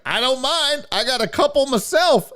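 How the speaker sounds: put-on high, folksy old man voice; creepy dad voice. Dad offering the mother help, high folksy voice. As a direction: play the pushy helpful dad. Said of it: "He was like".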